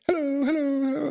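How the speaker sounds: women calling hello. Retold or imitated.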